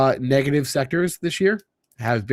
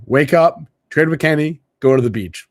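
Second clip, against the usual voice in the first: louder, punchy list; emphatic dream; 4 semitones higher, 7 dB louder than usual. Real, not acted. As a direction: punch each step of the dream. Stating his dream emphatically.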